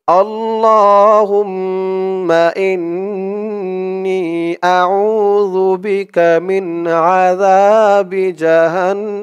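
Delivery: slow, drawn-out recited Arabic; model recitation. Shown as a demonstration. Teacher models the word for viewers to repeat, slow recitation. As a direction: stretch every syllable for them to copy. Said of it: "আবার বলুন আমার সাথে".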